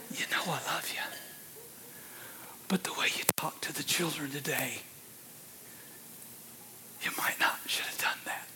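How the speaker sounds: soft unvoiced whisper. Preacher voices his wife whispering in bed, hushed. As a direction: breathe it like a wife at bedtime. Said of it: "she whispers".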